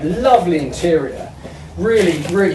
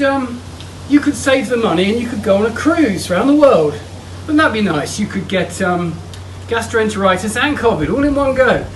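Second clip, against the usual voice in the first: usual speaking voice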